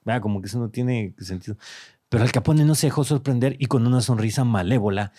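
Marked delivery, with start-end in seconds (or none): 2.1-5.1 dramatic storytelling read; story narration. Acted. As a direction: narrate it like a dark tale. Reads AI story aloud.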